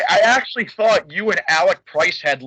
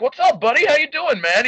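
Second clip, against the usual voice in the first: put-on bright, higher and louder hello to a kid; friendly greeting; 9 semitones higher, 4 dB louder than usual. To a young fan at the show, an upbeat friendly greeting.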